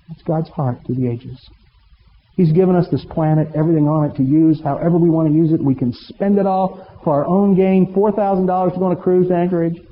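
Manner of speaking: ordinary delivery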